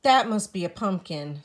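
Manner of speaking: Spook deciding. A narrator's reading. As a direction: settle it firmly. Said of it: "Spook decides".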